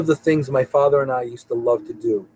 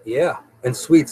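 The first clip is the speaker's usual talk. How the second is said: ordinary talk, nothing marked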